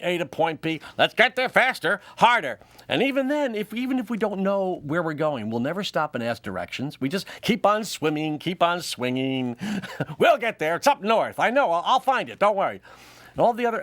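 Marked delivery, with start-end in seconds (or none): 1.0-2.6 louder, driving the pace; mock urgent push. Sperm pushing to the egg, mock urgent shout. 10.2-12.8 louder, a bit higher, brushing off worry; the man who won't ask directions; stubborn guy voice. Acted. Male sperm to partner, brushing off directions, breezy.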